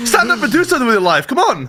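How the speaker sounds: a lightly hammed-up hype coach; motivational speaker. Mock pep talk pushing lazy listeners up fast. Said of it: "Motivational podcast now".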